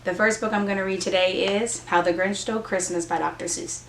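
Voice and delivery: ordinary delivery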